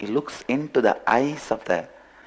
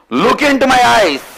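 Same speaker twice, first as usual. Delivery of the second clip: much higher and louder, commanding; lion's command; 12 semitones higher, 8 dB louder than usual. Acted. Lion ordering the cub to look into his eyes, loud. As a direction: command him to meet your gaze.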